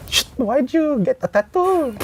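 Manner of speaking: put-on high Filipino mom accent. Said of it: "in full thick Filipino accent".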